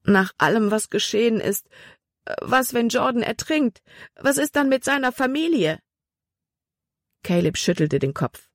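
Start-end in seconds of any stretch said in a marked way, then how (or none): 0.0-5.8 worried questions rising higher; anguished worry. A narrator's reading. Libby fears Jordan may drown, anguished worry. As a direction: fret aloud, pitch climbing as the fear grows. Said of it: "Libby stöhnte".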